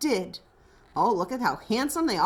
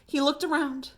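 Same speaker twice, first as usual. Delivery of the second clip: ordinary delivery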